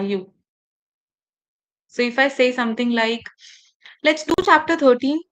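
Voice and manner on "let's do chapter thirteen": THIRTEEN lifted like a question, higher and louder; upward intonation. Shown as a demonstration. Teacher giving a class an instruction as a question, upward intonation. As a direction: end on a rising THIRTEEN, seeking approval. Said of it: "my voice is going up".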